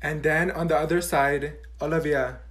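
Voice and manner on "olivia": ordinary talk, nothing marked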